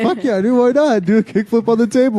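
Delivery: high, excited egging on; hyped urging. Retold or imitated. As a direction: cheer him into the trick. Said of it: "And I was like".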